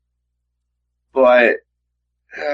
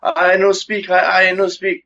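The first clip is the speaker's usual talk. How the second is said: mock broken-English accent, pitched high; high mock non-native English accent; Anderson Silva; 7 semitones higher than usual. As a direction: put on a foreign accent, higher than usual. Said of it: "It's like Anderson Silva".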